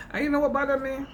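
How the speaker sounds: rising Jamaican accent. Real, not acted. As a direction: passionate question in a Jamaican accent. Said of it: "I would go straight into my Jamaican accent".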